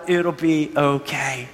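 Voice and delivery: calm, unhurried promise that settles low; gentle reassurance. Retold or imitated. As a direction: ease each word out to reassure. Retells the friend's reassurance.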